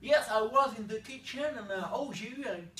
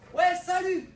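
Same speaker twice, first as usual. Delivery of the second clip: high, loud distant greeting; far-off hello; calling from afar; 10 semitones higher, 10 dB louder, slower than usual. Retold or imitated. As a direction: call out across the street.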